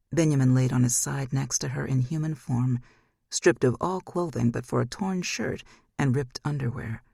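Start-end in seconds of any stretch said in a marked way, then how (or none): none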